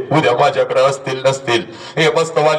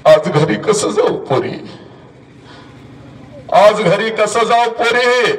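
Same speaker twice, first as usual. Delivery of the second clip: higher, slow crying-out of the line; high wailing plea; 6 semitones higher, slower than usual. Retold or imitated. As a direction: wail it out as the mother breaking. Mother's lament repeated. (उंच, संथ आक्रोश)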